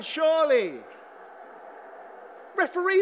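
normal talking voice

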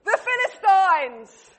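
loud, projected narrator opening a battle tale; story narration. A narrator's reading. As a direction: narrate grandly to a crowd, slowing at line ends. Narrates the play to the room.

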